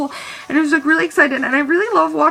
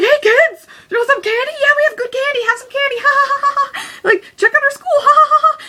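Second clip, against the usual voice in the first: put-on high, loud, over-the-top sales pitch to kids; manic kid-greeter; 12 semitones higher, 4 dB louder than usual. Teen calling kids to the booth, manic and shrieky. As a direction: squeal it with forced cackling laughs.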